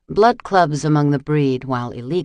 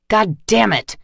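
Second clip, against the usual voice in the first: higher, louder furious curse; angry growl; 6 semitones higher, 5 dB louder than usual. Acted. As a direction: snarl it in frustration. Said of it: "Tegan growled".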